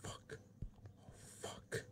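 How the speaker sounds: hushed, voiceless curse; spooked whispered swearing. Real, not acted. Player startled by a noise in a horror game, spooked whisper. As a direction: swear very softly under the breath.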